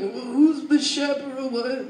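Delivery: slow, groggy mock dad voice; imitating a dozy, confused dad; sleepy dad. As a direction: answer like a father half asleep. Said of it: "he's just asleep".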